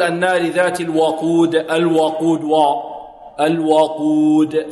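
الوقود with the waw wrongly thickened and rounded, like "wo"; over-heavy waw. Shown as a demonstration. Teacher shows students the mistake, heavy waw in الوقود. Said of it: "خطأ".

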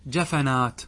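جفنات as "jafanaat", ف taking the a of ج; careful "ja-fa-naat" for جفنات; vowel copied to second letter. Shown as a demonstration. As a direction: say جفنات with short a after ف. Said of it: "يجب فيه الإتباع".

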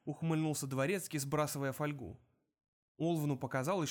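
ordinary delivery, nothing marked

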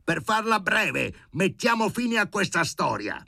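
shouting. A narrator's reading. The professor shouting at Schwonder to end the row, furious shout. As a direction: bark it out to end it. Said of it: "gridò".

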